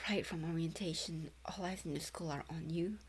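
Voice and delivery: breathy unvoiced whisper of the line; whispered read. Streamer reading a rumour line to viewers, whispered. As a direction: hush it like a secret rumour.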